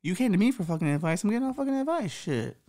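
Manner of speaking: ordinary talk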